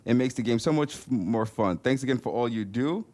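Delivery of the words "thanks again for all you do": normal talking voice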